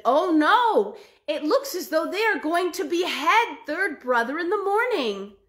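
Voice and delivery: worried sibling reporting; dismayed second brother. Acted. Second brother to his brothers, dismayed.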